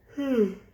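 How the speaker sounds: high heavy sigh; loud sigh. Real, not acted. Reader sighs before retake.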